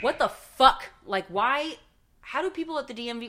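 loud, disbelieving what the fuck; outraged outburst. Retold or imitated. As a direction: blurt it out in outrage. Outburst at DMV clerk.

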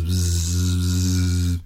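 buzzing low into an ear; be the bee droning bzz. Acted. Bee buzzing at the old goat's ear, a low drone.

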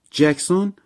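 high, loud questioning echo; surprised echo. A narrator's reading. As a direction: bounce the name back as a startled question. Echoes a name just heard. (با تعجب و لحن پرسشی)

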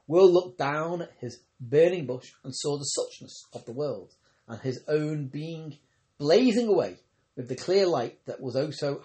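usual speaking voice

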